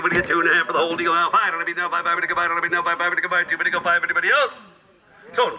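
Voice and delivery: normal talking voice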